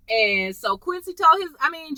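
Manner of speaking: ordinary talk, nothing marked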